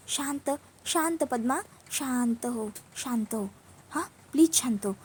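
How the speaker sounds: soft, hushed calming; soothing. Acted. Urja calming her crying sister Padma, soothing. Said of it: "ऊर्जा आता पद्माला शांत करते".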